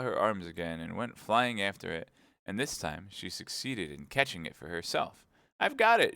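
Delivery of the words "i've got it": much higher and louder triumphant shout; triumphant cry. A narrator's reading. The Queen catching her shawl, triumphant cry. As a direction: crow with triumph. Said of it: "she cried in a triumphant tone".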